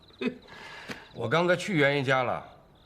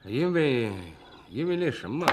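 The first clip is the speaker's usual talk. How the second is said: halting, evasive half-answer; hemming and hawing; 3 semitones lower, slower than usual. Acted. Asked why he was beaten, hesitant and evasive. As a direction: stall with broken starts, avoiding the confession. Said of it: "别吞吞吐吐的".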